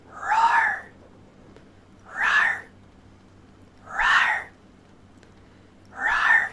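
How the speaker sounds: a string of playful roars, some breathy and some yelled; repeated roars. Shown as a demonstration. Streamer testing the roar trigger word over and over, playful roars. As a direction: try out the roar every way you can, cute to fierce. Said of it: "Wonder how I feel doing it".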